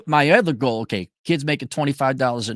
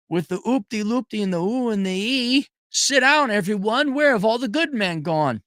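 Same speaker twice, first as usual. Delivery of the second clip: mocking high voice; 9 semitones higher than usual. Mocking caller.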